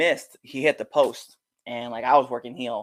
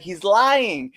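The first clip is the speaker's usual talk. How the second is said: high, loud call-out; goading accusation; 11 semitones higher, 5 dB louder than usual. Retold or imitated. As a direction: denounce him to the crowd, pitched up. Wrestler accusing boyfriend loudly.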